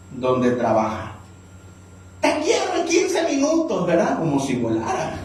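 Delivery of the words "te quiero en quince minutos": demanding boss. Retold or imitated.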